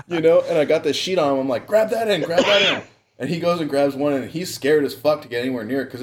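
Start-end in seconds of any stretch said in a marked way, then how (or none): 1.7-2.2 high urgent call to the brother. Retold or imitated. Calling to brother during the catch. 2.2-2.8 raised, urgent repeat of the order; calling out. Retold or imitated. Calling to brother during the catch.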